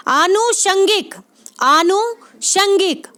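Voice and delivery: आनुषंगिक slowly split, long aa-nu then short shang-gik; long initial vowel. Shown as a demonstration. Teacher showing the correct spelling by sound, slow long aa. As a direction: draw out the first aa of आनुषंगिक and break before षंगिक. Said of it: "आ बड़ा आ आएगा".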